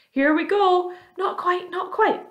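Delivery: high-pitched, excited cries; excited calling out. A narrator's reading. Creatures anticipating the peach breaking free, excited calls. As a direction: call out high as it lurches. Creatures call out as room lurches.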